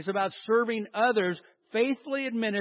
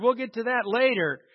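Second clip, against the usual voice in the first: ordinary talk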